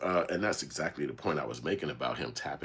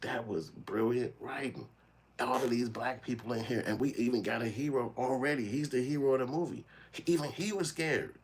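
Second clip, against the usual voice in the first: amazed; 9 semitones higher than usual. Real, not acted. Excited praise of the scene.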